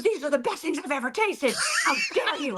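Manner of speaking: mock-outraged praise, half laughing; imitated indignant rave. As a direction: complain and rave at once, hamming it up. Angry customer now raving, retold.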